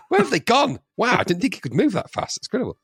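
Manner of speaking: high, hushing wonder; mock amazement. Audience after a smoke-bomb vanishing, mock amazement. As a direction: marvel at it, pitched right up.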